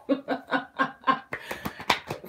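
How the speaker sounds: high, breathy run of laughter; helpless laugh. Real, not acted. Laughs over spilled soda.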